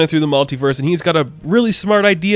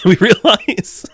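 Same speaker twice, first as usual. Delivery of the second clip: higher, slowed by laughter; laughing; 7 semitones higher, much slower than usual. Real, not acted. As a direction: crack up while getting the words out. Host cracks up mid-recap.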